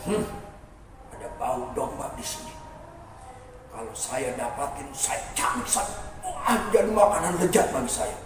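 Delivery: low, hushed wolf voice; scheming softly while sniffing out prey; prowling wolf. Acted. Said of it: "Serigala itu bilang".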